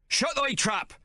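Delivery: high barked order; Stubbs shouts. A narrator's reading. Mate shouting Hawk down, high bark. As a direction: silence him with a shout. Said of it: "Stubbs shouts at Hawk".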